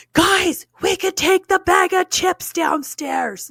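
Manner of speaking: put-on high, loud squeal of excited children; excited kids. Kids tell each other about snacks downstairs, excited. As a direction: imitate thrilled kids calling out to each other. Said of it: "they were so freaking excited".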